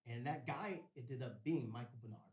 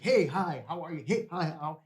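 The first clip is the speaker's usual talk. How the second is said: loud, lifted run of greetings; mock brisk greeting; 5 semitones higher, 13 dB louder than usual. Spiritual leader greeting everyone down the line, brisk and loud. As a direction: imitate someone hailing each person in turn.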